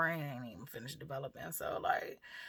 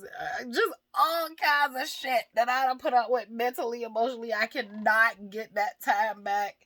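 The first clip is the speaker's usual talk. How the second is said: high, loud, emphatic frustration; exasperated venting; 4 semitones higher, 7 dB louder than usual. Real, not acted. A woman venting about what the relationship cost her, exasperated. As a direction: vent it, pitched up and punching the words.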